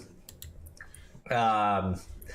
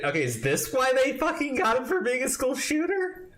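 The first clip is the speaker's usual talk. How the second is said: normal talking voice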